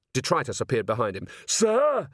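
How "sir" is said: high, prompt acknowledgement; troll on duty, pitched way up; troll sergeant. A narrator's reading. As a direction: answer like a troll reporting for orders. Troll answers captain.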